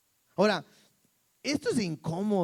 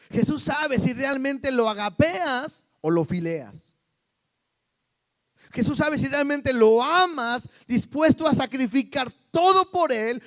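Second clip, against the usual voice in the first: high, loud pulpit challenge; forceful preaching; 7 semitones higher, 8 dB louder than usual. Real, not acted. Preacher challenging the congregation's love for Jesus, forceful.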